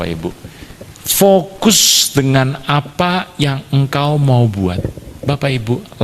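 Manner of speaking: ordinary talk, nothing marked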